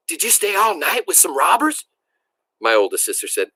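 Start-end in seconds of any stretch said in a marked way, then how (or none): 0.1-1.9 put-on much higher, eager small-child voice; piping little girl. A narrator's reading. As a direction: pipe up like a curious little sister. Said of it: "My youngest sister piped up".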